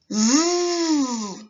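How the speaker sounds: a loud voiced z sound; buzzing z. Shown as a demonstration. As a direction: hold a buzzing Z so the throat vibrates. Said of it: "Repeat after me".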